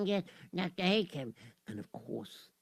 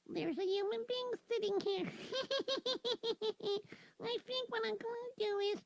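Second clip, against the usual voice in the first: very high, squeaky little critter; giggly little worm, way up high; tiny worm voice; 14 semitones higher than usual. Acted. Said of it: "if the worm could talk, he would probably say".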